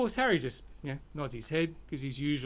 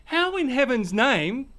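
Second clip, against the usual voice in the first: exasperated rant; 7 semitones higher, 5 dB louder than usual. Acted. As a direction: lose patience and let it build. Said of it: "Harry's just had enough".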